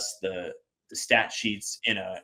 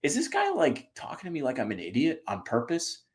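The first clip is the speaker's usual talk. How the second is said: incredulous thought; 5 semitones higher, 4 dB quieter than usual. Retold or imitated. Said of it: "And I was like".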